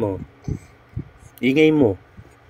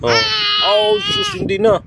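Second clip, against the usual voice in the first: put-on much higher, teasing baby-talk voice; squeaky baby talk. Mother teasing her angry baby in baby talk.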